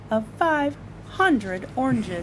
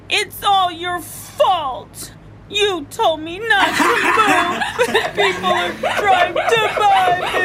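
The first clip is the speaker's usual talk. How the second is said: put-on complaining child; 10 semitones higher, 5 dB louder than usual.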